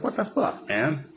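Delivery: high incredulous squeak; disbelief. Retold or imitated. Fan reacting to the Batboat destroyed, high mock disbelief. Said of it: "I was like".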